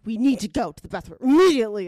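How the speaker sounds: urgent, stressed retelling with a loud finish. Retold or imitated. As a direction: act out a stressed plea, punching the last word. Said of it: "I was, like, so stressed out".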